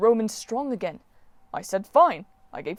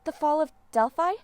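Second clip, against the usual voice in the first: higher, startled rise on Delphi; surprised question; 8 semitones higher than usual. A narrator's reading. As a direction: catch the phrase in disbelief. Hazel made a timeout sign.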